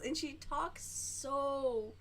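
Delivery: very slow, drawn-out mock of her speech; imitating slow talker. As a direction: stretch every word like she does. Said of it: "that's what she sounds like".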